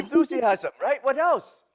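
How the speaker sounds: hammed-up Japanese accent with dropped grammar; Mister Miyagi. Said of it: "my very bad Mister Miyagi accent".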